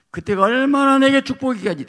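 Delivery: loud, high sustained cry to the Father; fervent prayer. Retold or imitated. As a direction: cry it out as the young man praying. Preacher voices his prayer to Father. (젊은 날 아버지께 외치는 기도처럼 높고 크게)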